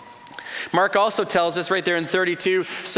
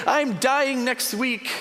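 slow, high, incredulous protest; exasperated retort; 8 semitones higher, much slower than usual. Retold or imitated. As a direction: spell it out to tactless friends. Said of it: "That's what you'd wanna say".

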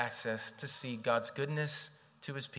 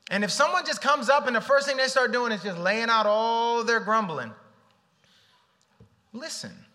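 preaching build; 8 semitones higher, 8 dB louder than usual. Acted. Preaching rises.

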